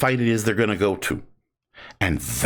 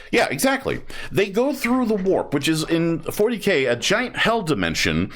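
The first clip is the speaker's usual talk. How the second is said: excited; 8 semitones higher than usual. Real, not acted. Gushing about orcs.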